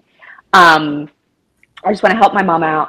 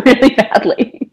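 ordinary talk, nothing marked

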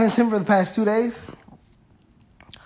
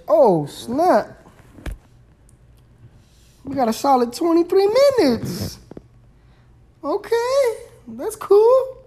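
surprised; 11 semitones higher, 5 dB louder than usual. Real, not acted. Surprised by remaining time.

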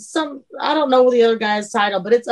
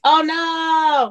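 higher, drawn-out pretend dismay; mock alarm; 6 semitones higher than usual. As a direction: wail it in hammed-up horror. Rehearses reaction to fake fainting.

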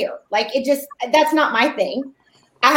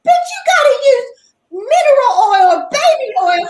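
high, louder wail; mock crying daughter; 10 semitones higher, 4 dB louder than usual. Daughter wailing at mom to use baby oil. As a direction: whine it like she's in tears. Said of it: "And she goes".

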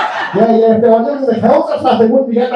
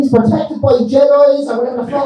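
ordinary talk

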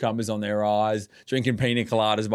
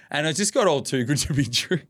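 ordinary talk

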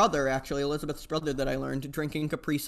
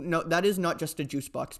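ordinary talk

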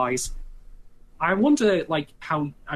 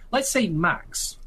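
let's say Max drawn out slowly and higher, hypothetical; 6 semitones higher, much slower than usual. Shown as a demonstration. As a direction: stretch out let's say Max, musing. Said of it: "let's just hypothesize it".

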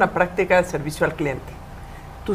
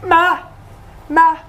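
put-on child begging mom; 6 semitones higher than usual.

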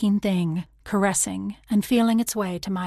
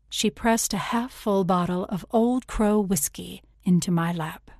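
ordinary delivery

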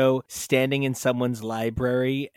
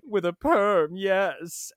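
lightly voiced Mephisto; 4 semitones higher, much slower than usual. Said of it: "Mephisto's like".

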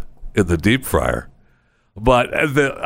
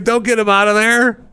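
exasperated advice; 10 semitones higher, slower than usual. Retold or imitated. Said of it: "I'm thinking".

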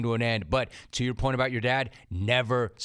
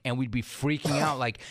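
normal talking voice

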